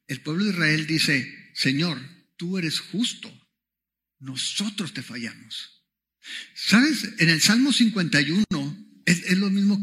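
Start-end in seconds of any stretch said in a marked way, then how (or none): 1.5-5.8 slow, softening voice to the end; humble confession. Acted. Preacher voicing Israel admitting failure to God, humbly subdued. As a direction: confess it quietly, dropping lower at the close.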